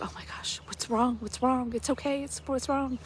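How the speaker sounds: urgently asking what's wrong, a touch of panic; alarmed concern. Retold or imitated. Hazael startled as Elisha suddenly weeps, anxious.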